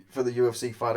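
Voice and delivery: ordinary talk, nothing marked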